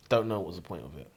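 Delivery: usual speaking voice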